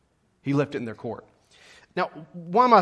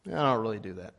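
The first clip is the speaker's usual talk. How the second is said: deadpan brush-off; 8 semitones lower, 8 dB quieter, faster than usual. Retold or imitated. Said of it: "She looks and goes".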